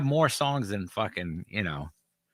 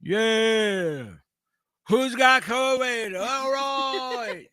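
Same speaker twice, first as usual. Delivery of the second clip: rock frontman; 9 semitones higher, much slower than usual. Retold or imitated. Frontman stage banter impression.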